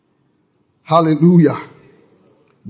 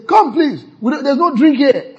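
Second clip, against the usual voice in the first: guest calling; 11 semitones higher, 5 dB louder than usual. Pretend. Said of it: "you say".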